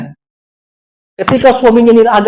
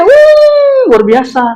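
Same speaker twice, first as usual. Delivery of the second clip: a sudden high exclaim of wonder; mock amazement; 13 semitones higher, much slower than usual. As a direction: ham up the churchgoer's awe. Mocking showy church fervour. (seruan kagum yang dibuat-buat)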